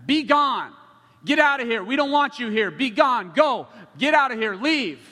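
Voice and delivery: loud, high, forceful shouts; angry yelling. Retold or imitated. Man on a porch yelling at a street minister, loud hostile shout. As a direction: yell to drive someone off. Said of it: "one of them starts yelling at him".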